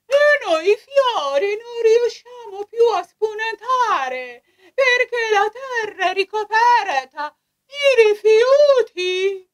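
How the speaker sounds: high, crying flower lament; whimpering little flower voice; squeaky shrill puppet voice. Acted. Flowers lament under garbage.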